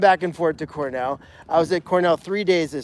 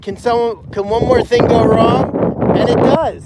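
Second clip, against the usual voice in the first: a raised, pleading cry at bad luck; exasperated; 4 semitones higher, 6 dB louder than usual. Retelling a rough week, in mildly exasperated pleading. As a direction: throw the question up to the sky, fed up. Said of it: "You're like".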